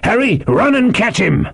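high, urgent landlord's order; indignant sailor calling out; crying out. A narrator's reading. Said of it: "cried Silver".

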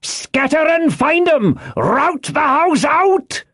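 high, drawn-out shouted commands; insistent orders. A narrator's reading. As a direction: yell each order slowly at high pitch. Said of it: "reiterated Pew".

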